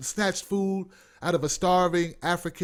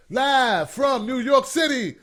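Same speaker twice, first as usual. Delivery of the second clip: high, drawn-out announcer shout; show announcer call; 6 semitones higher, 4 dB louder, slower than usual. Pretend. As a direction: call it out like opening a live show.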